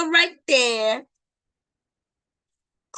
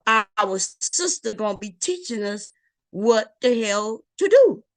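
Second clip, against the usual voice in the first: ordinary talk, nothing marked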